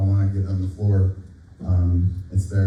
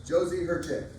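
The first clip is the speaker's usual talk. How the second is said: projected, higher announcing voice; teacher voice; 8 semitones higher, 10 dB quieter than usual. Real, not acted. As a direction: call the names out across the room. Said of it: "Can I use my teacher voice?".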